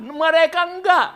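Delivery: ordinary talk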